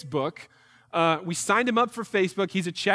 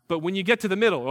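ordinary talk

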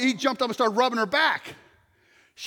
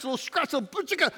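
usual speaking voice

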